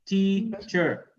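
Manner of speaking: "Teacher" in two separate beats, TEA-cher; split into syllables. Shown as a demonstration. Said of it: "We have two syllables".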